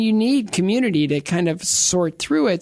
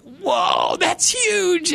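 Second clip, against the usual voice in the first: amazed whoa; 12 semitones higher, much slower than usual. Real, not acted. Awed by God's love.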